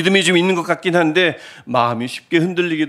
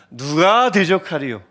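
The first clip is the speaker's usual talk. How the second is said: usual speaking voice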